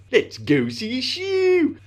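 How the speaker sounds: high, loud, drawn-out silly voice. Retold or imitated. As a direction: squash the vowels and send the last word sky-high. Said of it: "became something that we just said".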